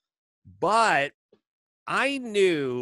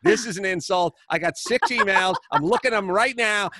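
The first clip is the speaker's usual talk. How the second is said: 1 mock indignation; 6 semitones higher, 5 dB louder than usual.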